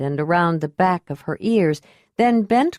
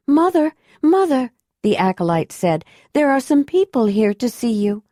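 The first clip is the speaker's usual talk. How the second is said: put-on lightly raised young woman's voice; young acolyte; 6 semitones higher than usual. A narrator's reading. Acolyte daughter voiced by narrator.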